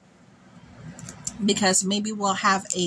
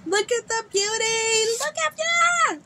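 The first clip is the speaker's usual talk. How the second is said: very high, slow squealed gushing; delighted squeal. Real, not acted. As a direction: squeal and stretch it out in adoration. Showing off adored new makeup.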